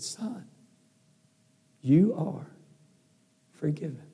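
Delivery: soft, spaced-out fatherly words; hushed, deliberate pardon with long pauses; tender forgiveness. Retold or imitated. Dad tenderly forgives son.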